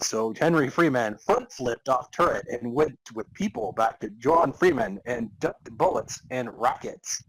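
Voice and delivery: ordinary talk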